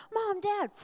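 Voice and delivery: high, loud burst of a call to the parents; excited call. Retold or imitated. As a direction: call out like a kid bursting through the door. Son bursting in, calling out.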